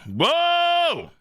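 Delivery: high excited whoa; real gasp of surprise; surprised whoa. Real, not acted. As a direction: blurt it out amazed. Reacting loudly to the chapter.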